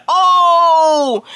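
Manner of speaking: loud, high startled cry; shocked shout. Retold or imitated. The room reacting to the Bible smack, loud shocked shout.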